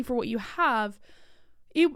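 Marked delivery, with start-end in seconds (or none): 1.7-1.9 hiccup interrupting words; catching a hiccup mid-sentence. Real, not acted. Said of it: "I have the hiccups".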